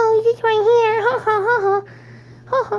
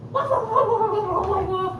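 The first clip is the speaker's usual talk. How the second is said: dog barking; 3 dB quieter than usual. Shown as a demonstration. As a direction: bark like a puppy for the dog. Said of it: "What sound does a doggy make?".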